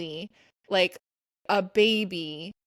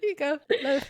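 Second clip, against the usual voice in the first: mock baby handoff; 6 semitones higher than usual. Handing baby back voice.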